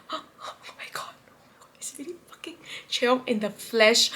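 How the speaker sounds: gasp, then hushed disbelief; barely voiced amazement; awestruck whisper. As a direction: whisper it breathlessly, starstruck. Reliving awe on facing idol.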